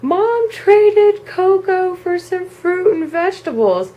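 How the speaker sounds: high, drawn-out mock-tearful report; retold kid's anguished wail; wailing complaint, acted out. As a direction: whine it out to dad in dismay. Acts child wailing news to dad.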